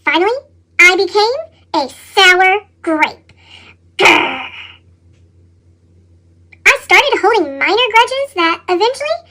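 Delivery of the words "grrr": growl. A narrator's reading. Sour grape growls.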